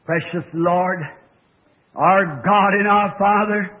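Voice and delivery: slow, reverent prayer voice. Acted. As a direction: pray over the congregation earnestly. Leads closing prayer.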